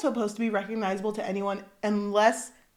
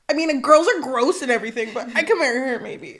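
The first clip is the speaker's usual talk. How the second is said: mock reluctant agreement; 4 semitones higher, 7 dB louder than usual. Mock reluctant prince quote, laughing.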